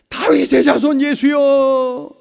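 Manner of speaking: high, slow, loud cry of a blind beggar; stretched-out pleading call. Acted. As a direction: call out to Jesus in desperate hope. Preacher voicing Bartimaeus crying out.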